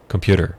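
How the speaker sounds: "computer" run together as "compyooder"; D in place of T. Shown as a demonstration. Teacher repeating the D-like form, quick pronunciation. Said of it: "como se um D estivesse ali".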